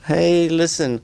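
normal talking voice

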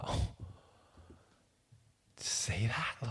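conspiratorial whispered aside; furtive whisper. Retold or imitated. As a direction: whisper it secretly to a mate. Retold furtive aside to friend Nath.

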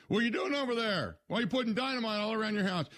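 high-pitched, incredulous questioning; alarmed onlooker. Acted. Neighbour to homeowner putting dynamite round the house, alarmed high voice. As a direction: call out in high-voiced disbelief.

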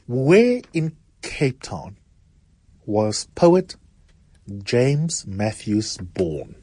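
slow, weighty quiz-host delivery; slow dramatic question. Acted. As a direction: draw out each phrase for suspense. Quiz host reads final question.

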